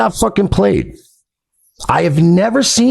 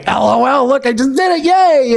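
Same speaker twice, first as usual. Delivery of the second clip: high, giddy mocking voice; mock gloating; 10 semitones higher than usual. Quoting the ex bragging to his haters, mock gloating. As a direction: climb higher into a fake cheer.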